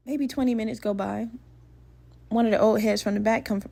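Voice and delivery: ordinary talk, nothing marked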